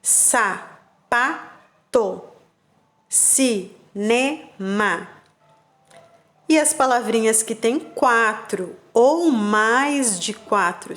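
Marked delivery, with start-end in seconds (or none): 0.0-5.1 very slow, each syllable pulled apart; syllables drawn out. Shown as a demonstration. Teacher modeling syllables to children, slowly broken up. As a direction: stretch every syllable for the kids. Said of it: "E aí tem o exemplo".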